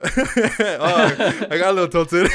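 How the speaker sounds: real laugh after a rant. Real, not acted. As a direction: laugh at yourself while admitting it. Admits getting worked up, laughing.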